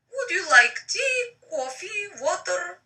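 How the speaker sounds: lifted, polite receptionist voice. Acted. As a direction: offer drinks like a sweet assistant.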